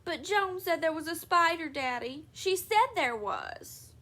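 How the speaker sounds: put-on girl insists to her father, whiny and high; child insisting. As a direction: plead your case like a little girl.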